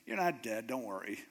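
low, softening voice of God; reassuring God. Acted. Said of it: "he hears God's voice going".

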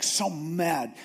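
usual speaking voice

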